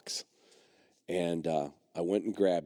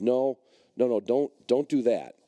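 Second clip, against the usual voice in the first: quick raised no, no, no; the vet urgently forbids it, raised voice; urgent warning; 5 semitones higher, 4 dB louder than usual. Retold or imitated. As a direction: stop him firmly.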